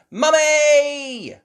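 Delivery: put-on high, loud, long child's call; excited cry. Child bursting with surprise news, crying out. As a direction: cry out for Mum gleefully. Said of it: "cried Peppa".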